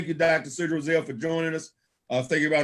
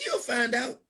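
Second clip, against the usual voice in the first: higher, giggly imitation of a knowing reply; giggling; 7 semitones higher, slower than usual. Big man in the corner repeating his answer, giggling. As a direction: say it through a chuckle, imitating him. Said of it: "and he's just giggling".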